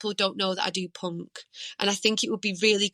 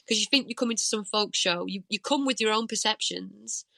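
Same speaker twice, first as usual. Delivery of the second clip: ordinary talk, nothing marked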